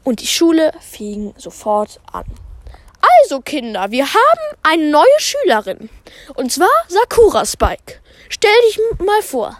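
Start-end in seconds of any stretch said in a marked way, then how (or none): 3.2-9.6 higher cheerful teacher addressing class. Acted. Teacher Pam introduces the new pupil, higher and bright. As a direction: sing it out like a chipper teacher.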